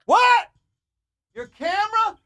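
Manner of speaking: high, loud, incredulous What; shocked disbelief. Retold or imitated. Telling how he reacted to the girl's camera news, a high shocked yell. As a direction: yelp it out in stunned disbelief. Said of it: "I go".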